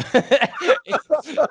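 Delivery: bright high burst of laughter; real laugh after the punchline. Real, not acted. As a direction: laugh at your own joke. Laughs again.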